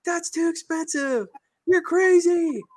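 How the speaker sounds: high-pitched mock-panicked yelling; mind shouting. The mind objecting to the gut, high mock shouting. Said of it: "the mind shouts".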